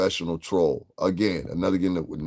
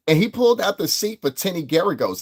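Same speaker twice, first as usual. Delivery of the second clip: higher mocking imitation of her; imitating a woman; 9 semitones higher, 4 dB louder than usual. Streamer mocking a rival host's claim, put-on higher voice. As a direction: mimic her breathless gossip. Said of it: "She was like".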